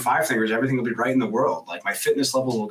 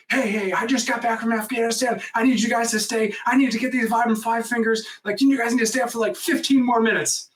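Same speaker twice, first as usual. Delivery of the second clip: high, insistent phone plea; urgent pleading; 12 semitones higher than usual. Retold or imitated. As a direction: beg them to wait, pitched way up. Retold urgent phone plea to store.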